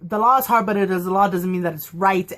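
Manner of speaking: ordinary talk, nothing marked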